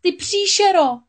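very high, loud furious cry; desperate cry. A narrator's reading. Ariel striking the witch, screaming in high desperate anger. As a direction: scream it at her in despair. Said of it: "vykřikla Ariel".

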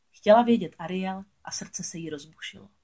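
ordinary delivery, nothing marked

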